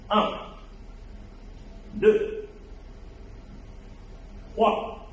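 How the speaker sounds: strained counting between reps; doing push ups. Real, not acted. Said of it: "je vais essayer de faire des bonds complètes".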